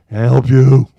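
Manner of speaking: loud, pointed call through the door. Retold or imitated. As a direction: project it at the kid on the porch. Calling to kid at front door.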